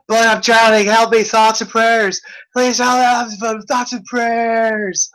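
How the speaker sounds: high, loud, frantic begging for help; desperate pleading. Pretend. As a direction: plead like you're going under. Skit, drowning man pleads.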